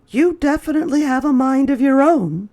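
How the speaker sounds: high, quick motherly voice; a mother fondly teasing her son; mother's voice. A narrator's reading. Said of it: "said Chester's mother".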